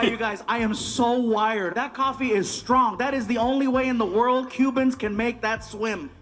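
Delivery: normal talking voice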